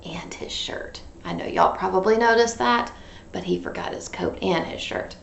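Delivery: whisper aside. Real, not acted. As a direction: lean in and whisper. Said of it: "aside to kids".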